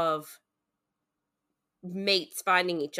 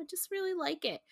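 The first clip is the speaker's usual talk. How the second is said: small high voice, noticeably softer; high, soft admission; 11 semitones higher, 7 dB quieter, faster than usual. Real, not acted. Admitting she really likes the soulmate idea, high and soft. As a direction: admit it lightly, up high and quiet.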